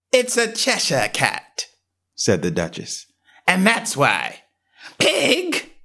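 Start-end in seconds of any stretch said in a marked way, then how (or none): 0.1-1.7 higher, slow, haughty lady's voice; a grand lady dismissing a question; curt Duchess. A narrator's reading. Duchess answers Alice. 3.5-4.4 higher, slow, haughty lady's voice; a grand lady dismissing a question; curt Duchess. A narrator's reading. Duchess answers. 5.0-5.7 put-on a sharp, very high burst at the baby; sudden violent shout. A narrator's reading. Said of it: "with such sudden violence".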